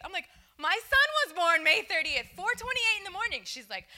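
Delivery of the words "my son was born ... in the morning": high, very loud outburst; amazed excitement. Retold or imitated. Telling a coworker their sons share a birthday, excitedly. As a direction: blurt out the astonishing coincidence.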